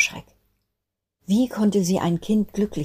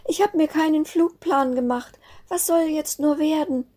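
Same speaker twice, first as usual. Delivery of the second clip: put-on much higher, strained weepy child voice; tearful despair; 12 semitones higher than usual. Sternenklar despairing to her siblings, high tearful voice. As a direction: cry out in helpless panic. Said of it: "weinte bitterlich".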